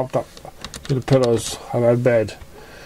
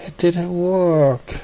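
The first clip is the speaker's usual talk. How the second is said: desperate; 7 semitones higher, much slower than usual. Acted. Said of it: "said Pink desperately".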